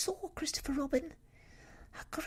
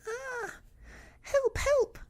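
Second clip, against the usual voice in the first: very high, squeaky Piglet shriek; panicked cry; 8 dB louder, slower than usual. A narrator's reading. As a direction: cry out for help in a tiny, high voice. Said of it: "cried Piglet".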